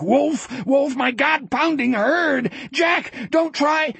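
high-pitched desperate yelling; frantic scream. A narrator's reading. Wolf screaming back at Jack about his herd, frantic scream. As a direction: scream over the thunder at a friend. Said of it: "Wolf screamed back".

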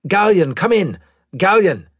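high, loud hail; urgent radio call. Acted. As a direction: call out over the comm for an answer. Pilot calls ship over comm.

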